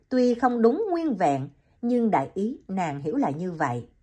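usual speaking voice

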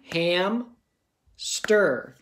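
"ham" and "stir" said as separate claps with a pause; clapping syllables. Shown as a demonstration. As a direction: clap out "ham", "stir" one by one. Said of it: "First I clap it".

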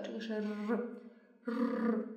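a slow, soft, trilled r; rolled r demo. Shown as a demonstration. Teacher isolating the rolled r slowly for students. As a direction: draw out the trill of r.

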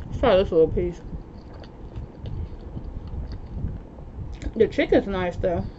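speaking between bites; chewing while talking. Real, not acted. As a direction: mouth partly full. Seen 3: Cheek bulges and chewing lips across frames; eating pizza throughout.

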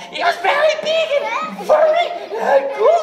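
very high, fast, breathless squeak; frightened sheep. Acted. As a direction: babble fast in a tiny high voice. Sheep describes the paw.

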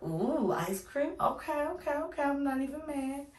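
playfully mock-excited, voice lifted high; playful excitement. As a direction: gush like a grown-up humouring a kid about ice cream. Reacting to boy's ice cream news.

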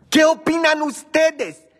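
high, loud and slow, reaching out to the audience; calling out to viewers. Real, not acted. Host calling out to her viewers, high and loud. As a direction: hail the chat with a stretched, lifted call.

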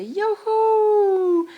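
put-on high, loud little-girl whoop; a little hare's first word, a gleeful shout; joyful whoop. A narrator's reading. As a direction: shout with delight down the slope. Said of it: "прокричала Мартіна".

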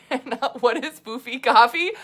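real laughter through the question; cracking up while asking; laughing. Real, not acted. Amused by a viewer's question.

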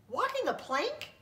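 very high, incredulous squeak; astonished. Acted. Narrator can't believe the pirates don't know the plank, very high surprise. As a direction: leap up in pitch in disbelief. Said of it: "narrator astonished".

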